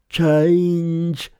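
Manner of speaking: high, loud magic command; spell incantation. A narrator's reading. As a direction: cast the spell on one drawn-out word. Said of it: "muttered a spell, and said".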